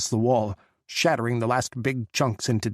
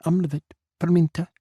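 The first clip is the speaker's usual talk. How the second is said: put-on lightly raised Czech-accented call; 6 semitones higher, 4 dB louder, faster than usual. Tipsy Czech woman ordering at the bar, light Czech call. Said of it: "She spoke again in Czech".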